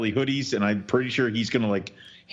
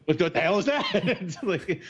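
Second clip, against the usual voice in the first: laughing; 8 semitones higher than usual. Retold or imitated. Repeats it laughing.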